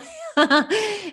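a quick high laugh at her own joke; high bright laugh. Real, not acted. Vlogger laughing at her own joke to viewers, high laugh.

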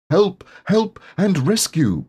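high urgent call; crying for help. A narrator's reading. Piglet calling the others to the rescue, high cry. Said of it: "Piglet cried".

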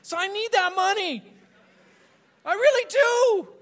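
greedy inner voice. Retold or imitated. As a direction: ham up the greedy thought in a silly high voice. Voicing his own greedy thought.

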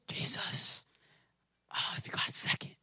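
mock-timid whisper; tiny, hushed, hesitant request; timid prayer. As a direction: whisper meekly, as if afraid to bother him. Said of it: "Not this".

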